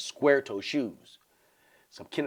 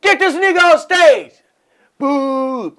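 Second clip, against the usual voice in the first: loud high heckle; mock heckler; heckler shout; 16 dB louder, slower than usual. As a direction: yell from the crowd.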